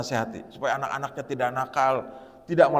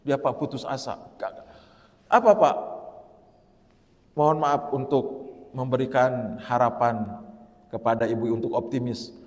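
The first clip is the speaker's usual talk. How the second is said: normal talking voice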